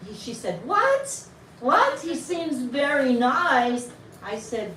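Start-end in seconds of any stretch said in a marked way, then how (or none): 0.7-2.0 high, loud incredulous yelp; mock outraged double take; shocked disbelief. Retelling her shocked reply. 2.0-3.9 higher, louder, drawn-out disbelief; mock-aghast slow repetition; incredulous echo. She echoes the answer back incredulously.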